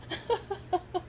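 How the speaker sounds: high, soft giggling; real laugh, high and quiet. Real, not acted. Vlogger cracking up at own insult, soft high laughter.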